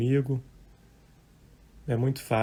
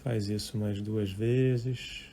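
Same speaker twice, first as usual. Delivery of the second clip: guided meditation; 9 dB quieter than usual. Real, not acted. Meditation teacher leading live listeners through breathing and thoughts, calm and unhurried. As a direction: guide listeners gently, leaving long silences between short phrases.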